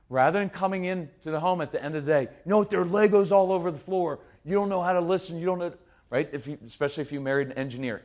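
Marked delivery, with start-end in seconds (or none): 2.2-5.7 imitated frustrated dad, higher and louder; a tired father scolding the kids, mock-annoyed. Dad scolding kids about Legos.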